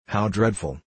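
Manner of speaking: ordinary delivery, nothing marked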